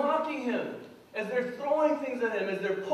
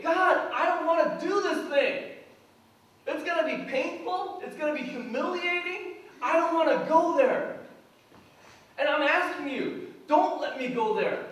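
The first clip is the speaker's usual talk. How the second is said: pleading prayer; 6 semitones higher, 4 dB louder than usual. Retold or imitated. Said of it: "he's sweating, he's crying, and he's saying".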